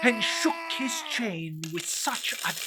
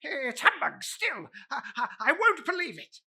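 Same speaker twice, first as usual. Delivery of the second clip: scoffing Scrooge; 9 semitones higher, faster than usual. Acted.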